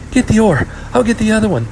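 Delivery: put-on high, loud girl's command; excited older sister taking charge; urgent order. A narrator's reading. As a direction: call out orders over the waves. Older girl ordering her sister.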